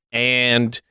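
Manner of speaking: usual speaking voice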